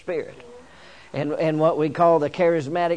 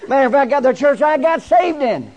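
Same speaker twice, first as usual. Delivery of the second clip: loud, high-pitched revival preaching; rally the congregation, voice raised high; full-force preaching; 6 semitones higher, 7 dB louder than usual. Real, not acted. Preacher wishing for a friend in this church tonight, full-force preaching.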